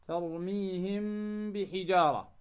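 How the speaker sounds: ترميهم بحجارة with the m held as a hum into the b; ikhfa of the m. Shown as a demonstration. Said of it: "وتكون طريقة النطق بها". (إخفاء ميم ترميهم عند الباء مع الغنة)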